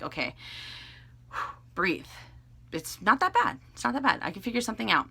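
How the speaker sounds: soft self-soothing, with a sigh before "breathe"; calming oneself. Retold or imitated. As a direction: talk yourself down quietly, pausing to breathe. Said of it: "calm down. I try to center myself".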